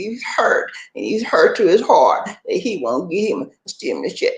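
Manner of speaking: usual speaking voice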